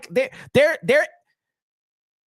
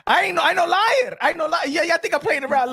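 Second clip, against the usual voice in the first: insistent; 7 semitones higher than usual. Real, not acted. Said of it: "I'm serious, bro".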